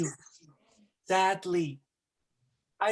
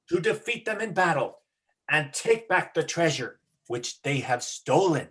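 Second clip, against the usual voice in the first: heroic declaration; 5 semitones lower than usual. Acted. Momotaro proclaims his quest to the dog, low and proud. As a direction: announce it like a brave young samurai.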